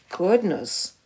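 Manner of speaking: ordinary delivery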